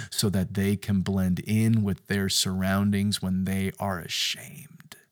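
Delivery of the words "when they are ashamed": hushed whisper on the last words; breathy hush of shame. Acted. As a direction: let it fall to a whisper. Preacher drops to whisper on shame.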